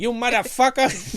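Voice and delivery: loud, high gangster snarl; Joe Pesci impression. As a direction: spit it out like a hothead mobster.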